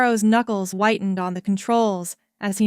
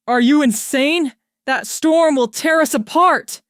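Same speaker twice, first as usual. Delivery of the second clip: higher, loud disbelieving protest; alarmed protest; 6 semitones higher, 6 dB louder than usual. A narrator's reading. Pilot protesting the captain's order, loud alarmed. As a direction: push back hard in alarm.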